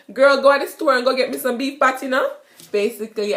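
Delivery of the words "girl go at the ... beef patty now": Jamaican patois. Shown as a demonstration. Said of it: "and I said".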